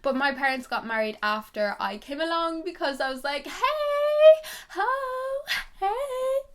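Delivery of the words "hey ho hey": high, loud singsong fanfare; singsong announcement. Pretend. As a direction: announce yourself like a star. Baby her announcing arrival.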